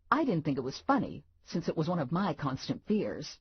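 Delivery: usual speaking voice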